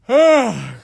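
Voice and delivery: loud yawning sigh; a big tired yawn. Real, not acted. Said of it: "Sorry, I've been yawning".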